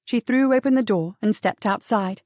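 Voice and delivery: ordinary delivery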